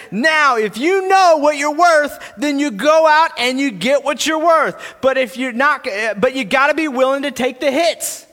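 rousing pep talk. Acted. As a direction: drive it home like a fighter's pep talk. Continues reading Rocky's pep talk.

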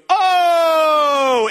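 loud, high shocked gasp of oh; crowd's shocked oh. Retold or imitated. The crowd reacting to Jesus's mic drop, loud shocked oh. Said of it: "Everybody goes".

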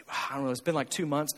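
low, soft, vague reply; sheepish couple. Retold or imitated. Husband vaguely answering the pastor about date night, low and soft. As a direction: imitate a hesitant, quiet admission. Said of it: "And they'll say".

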